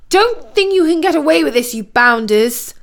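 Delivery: high outburst, slowing on the insult; indignant threat. A narrator's reading. Prince defying the queen and Prince Boris, indignantly. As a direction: shake a fist with the voice. Waving fist at his enemies.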